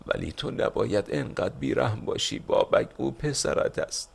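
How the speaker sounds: mother begging, voice rising to a breathy edge; moaning plea.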